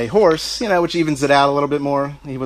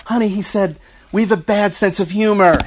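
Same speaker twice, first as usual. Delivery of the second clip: higher and louder, laughing through the words; dad's joke half-laughed out; laughing dad quote; 9 semitones higher, 6 dB louder than usual. Retold or imitated. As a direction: deliver dad's punchline while cracking up. Dad joking, everyone laughing.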